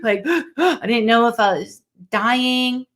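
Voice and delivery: sharp mock panic gasps; acted-out quick, panicky breaths; imitated hyperventilating. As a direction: gasp for air as if hyperventilating. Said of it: "I was just hyperventilating".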